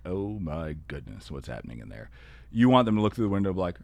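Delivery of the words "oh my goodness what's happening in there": a quiet onlooker shocked at the chaos; lower, softer mock dismay; hushed alarm. Passerby judging chaotic classroom.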